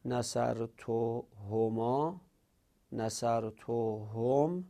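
slow, deliberate chant of نصر تو هو, نصر تو هما, نصر تو هم with each ending drawn out; teacher drilling the forms slowly; slow recital of pronoun forms. Shown as a demonstration.

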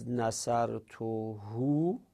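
slow, deliberate chant of نصر تو هو, نصر تو هما, نصر تو هم with each ending drawn out; teacher drilling the forms slowly; slow recital of pronoun forms. Shown as a demonstration.

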